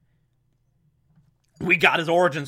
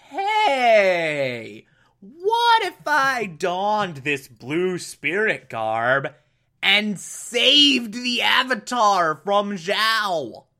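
drawn-out, higher mock brainwave; dawning idea; 4 semitones higher, much slower than usual. Zuko hatches a drastic solution, slow mock-inspired voice. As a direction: stretch the words as a bold plan forms.

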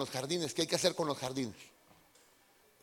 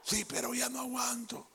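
whiny sick husband; 6 semitones higher, 6 dB quieter than usual. Retold or imitated. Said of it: "Y a la esposa".

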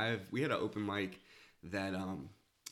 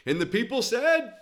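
preacher call; 13 semitones higher, 9 dB louder than usual. Retold or imitated. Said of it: "I'd be like".

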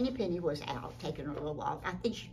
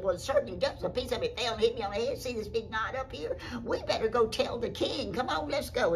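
loud, higher, insistent storybook voice; urgent hen; 8 semitones higher, 6 dB louder than usual. Acted. Henny Penny urges a trip to the king, urgent. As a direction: urge them on, building louder.

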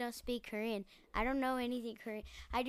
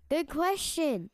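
dramatic slow reply; 5 semitones higher, 4 dB louder, much slower than usual. Acted. Acting out a scene from the show.

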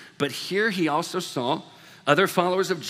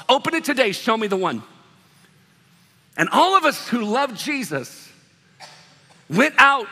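louder, higher, driving the point home; preaching at full force; 5 semitones higher, 5 dB louder than usual. Acted. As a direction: preach it out, rising and loud. Builds a call to action.

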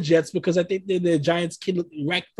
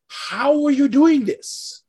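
incredulous; 8 semitones higher, 4 dB louder, much slower than usual. Retold or imitated. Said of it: "I'm like".

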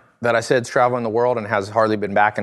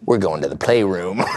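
sultry line; 4 semitones lower than usual. Retold or imitated. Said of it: "a sultry look and you go".

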